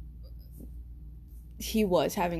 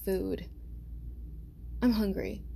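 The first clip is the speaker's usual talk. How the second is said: halting pauses; 6 dB quieter than usual. Retold or imitated. Friend pauses, looking weird on video chat, halting delivery. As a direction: imitate him with long odd pauses. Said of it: "and then he pauses".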